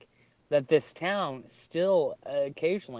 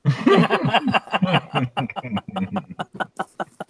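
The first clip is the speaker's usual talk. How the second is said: loud, long burst of laughter; hearty laugh; 4 semitones higher, 5 dB louder than usual. Real, not acted. Laughing hard at a friend's Texan joke, hearty laugh. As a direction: crack up at the punchline.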